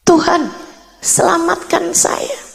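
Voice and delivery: high, hushed plea, fading into near-whisper; retold desperate prayer. Woman praying to God to save her, high pleading voice fading. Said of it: "mulai berdoa".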